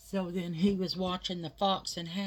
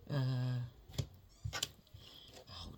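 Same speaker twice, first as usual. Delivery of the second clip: ordinary talk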